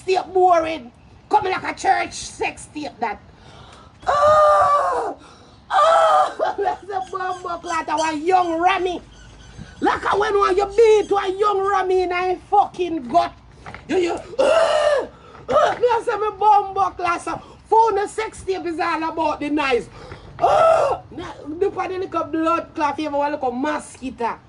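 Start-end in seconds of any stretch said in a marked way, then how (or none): 4.1-6.5 a higher, louder "ah" of laughing shock; shocked yelp. Real, not acted. Reacting to the sex tape, with a laughing yelp. 13.9-15.8 slow yelp. Real, not acted. Outburst at the clip. 20.4-21.0 high yelp. Real, not acted. Shocked reaction.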